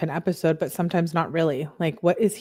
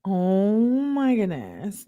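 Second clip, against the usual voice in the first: long drawn-out, astonished "oh"; stunned exclamation; 3 semitones higher, much slower than usual. Real, not acted. Reader reacting to a couple's text fight, stunned exclamation. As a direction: draw it out slowly in disbelief.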